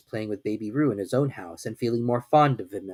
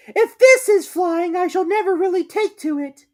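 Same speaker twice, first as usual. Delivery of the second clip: Piglet; 9 dB louder than usual. A narrator's reading. Piglet's thought while bouncing in the pocket.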